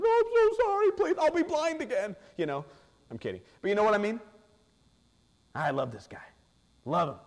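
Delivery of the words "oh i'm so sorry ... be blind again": much higher, louder whiny pleading; a hammed-up cringing apology; mock groveling. Preacher mocks a timid nobody begging, hammed-up whine. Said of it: "shut down and say".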